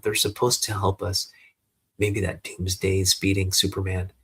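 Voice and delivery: ordinary delivery, nothing marked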